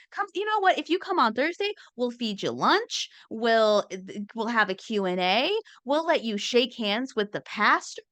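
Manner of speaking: hammed-up cheerful church invite; churchy recruiter. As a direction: lure them in sweetly like a greeter.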